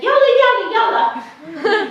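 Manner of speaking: high, loud calling yalla; voicing the old woman, laughing; urgent old woman. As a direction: hurry her along like an eager old auntie. Old woman urging her along.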